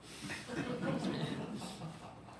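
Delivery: soft airy chuckle; quiet scoffing laugh; breathy laugh. Real, not acted. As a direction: laugh it off under your breath. Laughing at the prediction.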